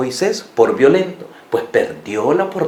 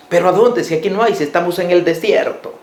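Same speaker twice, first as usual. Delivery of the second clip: protesting Moses; 6 semitones higher than usual. Retold or imitated.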